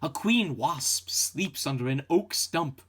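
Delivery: ordinary delivery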